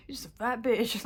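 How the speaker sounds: a mock drill-sergeant jab, voice changed; a hammed-up Goggins insult; David Goggins. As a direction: bark it like a tough-love coach, half joking. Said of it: "Like, David Goggins".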